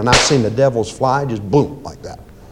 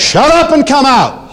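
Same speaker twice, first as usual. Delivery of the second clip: much higher, loud slow command; commanding shout; 11 semitones higher than usual. Shown as a demonstration. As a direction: bark it out slowly as a command. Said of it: "Say it out loud, please, boldly".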